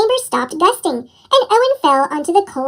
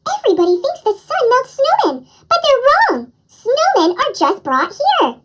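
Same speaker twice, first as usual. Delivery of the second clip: excited discovery; 6 semitones higher than usual. A narrator's reading. Owen's discovery.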